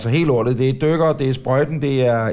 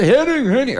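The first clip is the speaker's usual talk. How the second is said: high, raised call of a name; retold shout; 11 semitones higher than usual. Retelling how he called to Henning at the festival, retold shout. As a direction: call out to him, pitched well up. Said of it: "jeg råbte".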